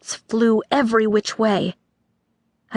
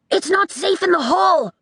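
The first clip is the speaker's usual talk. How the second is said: put-on higher alarmed boy voice; boy crying out; 9 semitones higher than usual. A narrator's reading. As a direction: warn them fast. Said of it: "the boy cried".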